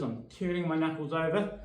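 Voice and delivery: ordinary talk, nothing marked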